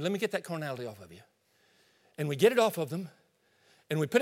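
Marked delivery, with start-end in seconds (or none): 0.0-1.2 quick, hushed and sheepish; fussing quietly to tidy up a mess; hurried quiet apology. Acted. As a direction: mumble it fast, embarrassed. Pastor acts out cleaning someone.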